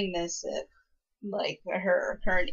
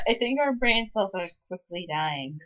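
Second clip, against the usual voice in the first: ordinary talk, nothing marked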